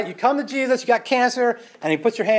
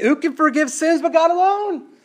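high, outraged religious leaders; indignant scribes; 8 semitones higher than usual. Retold or imitated. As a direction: scoff at the nerve of this man. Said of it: "They say".

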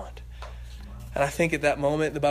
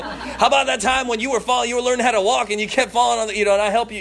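mild sarcastic father; 5 semitones higher, 7 dB louder than usual. Acted. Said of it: "get back up in his face".

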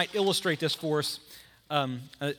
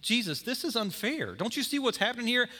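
frustrated Martha; 6 semitones higher than usual. Acted. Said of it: "she turns to Jesus and says".

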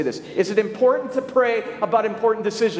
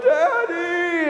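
high wailing call for daddy, mock crying child; a small hurt boy running in wailing; crying little boy; 11 semitones higher, 3 dB louder than usual. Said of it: "And he starts wailing, crying".

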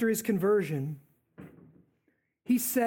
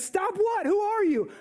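startled Saul; 11 semitones higher than usual. Acted.

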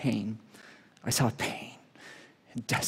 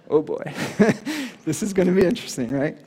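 nervous laughing; 4 semitones higher, faster than usual. Retold or imitated. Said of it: "So I was like".

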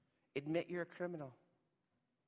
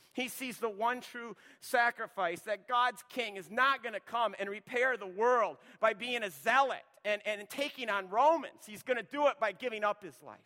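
loud, driving pulpit preaching; preaching at full force; 3 semitones higher, 10 dB louder than usual. Acted. Preacher pressing the church to choose, loud and driving. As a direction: hammer each either-or line home to the congregation. Preacher proclaims the true king.

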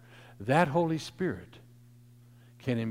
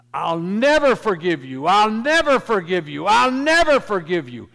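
retold scream; 7 semitones higher, 14 dB louder than usual. Preacher voicing a victim's sister to the murderer, screaming. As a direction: yell it at full volume as the victim's sister, hammering NEVER. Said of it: "she screamed at him at the top of her lungs".

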